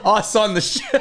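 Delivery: high, drawn-out words through laughter; laughing while talking. Real, not acted. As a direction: explain helplessly while cracking up. Clarifies while cracking up.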